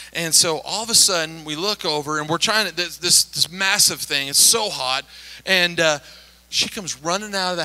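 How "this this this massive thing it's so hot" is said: normal talking voice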